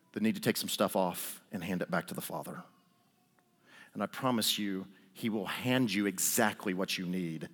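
soft, low, unhurried preacher guiding the room inward; preacher gently inviting the congregation to search their hearts, softly; quiet altar appeal. Acted. Soft invitation to reflect, low and unhurried.

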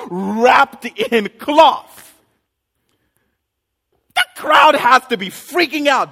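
high, loud, fervent preaching; full-force preaching. Acted. Pastor preaching the miracle to church, fervent full voice. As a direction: preach it to the rafters.

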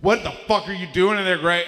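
mock outraged shout; screaming at the wall. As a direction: holler through the wall, fed up. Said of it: "I scream at the wall".

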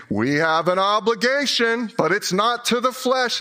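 high-pitched condemning preacher in the head; little preacher. Acted. Pastor voices the condemning preacher in his head, preachy. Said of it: "It sounds like this".

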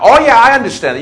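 high, loud, conspiratorial knowing tone; knowing reply. Retold or imitated. Shopkeeper knowingly humours the supposed celebrity, loud and high. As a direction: wink at him, you're in on the secret. Proprietor thinks he is hiding identity.

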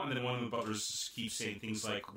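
ordinary talk

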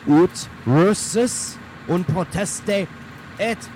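high chanted incantation; spell incantation. Acted. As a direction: cast the spell dramatically. Wizard casting a spell.

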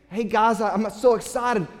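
bursting to share his news; excited kid brother. Acted. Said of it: "younger brother is saying".

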